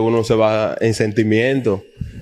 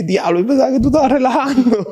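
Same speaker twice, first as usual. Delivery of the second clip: amazed exclamation; 13 semitones higher than usual. Retold or imitated. Teammate's amazed reaction retold.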